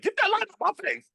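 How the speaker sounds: high, fast mock snap at the camera light. Friend filming in the club gets snapped at, high mock squeal. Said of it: "You was like".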